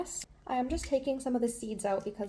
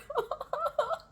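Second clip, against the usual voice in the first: squealing laugh, much higher; high pained laugh. Real, not acted. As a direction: let out a high helpless laugh. After spicy bite, pained.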